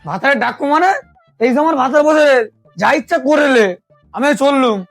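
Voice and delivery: angry shout. Acted. Wife storms out shouting.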